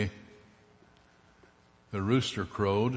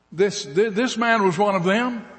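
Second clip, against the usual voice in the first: higher, louder insistent accusation; a crowd pressing the charge; accusing; 10 semitones higher, 6 dB louder than usual. Acted. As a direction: pin him down as one of them. Crowd accuses Peter again.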